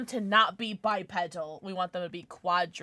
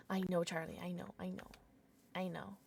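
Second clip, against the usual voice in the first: soft whispered comfort; soothing whisper; 3 semitones lower, 13 dB quieter, faster than usual. Real, not acted. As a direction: whisper gently to calm him. Soothing her pet.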